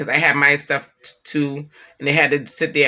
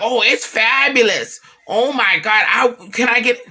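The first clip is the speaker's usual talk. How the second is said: higher, louder, slow over-the-top fake compliment; mock gushing praise; 7 semitones higher, 5 dB louder, slower than usual. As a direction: gush falsely like a polite relative. Mocking relatives' fake praise.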